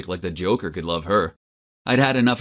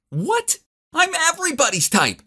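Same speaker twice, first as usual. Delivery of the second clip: put-on much higher outraged girl shout; shouted back. Rejected cheerleader shouts back at the boy, outraged. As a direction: shriek it in disbelief. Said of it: "She shouted back".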